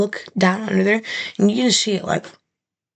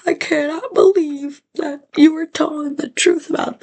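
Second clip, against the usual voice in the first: stunned relief; 7 semitones higher than usual. Retold or imitated. Retold relief after rescue.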